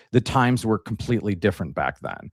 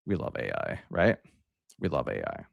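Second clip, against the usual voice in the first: sarcastic; 8 semitones lower, 8 dB quieter than usual. Real, not acted. Ironic remark about AI thumbnail.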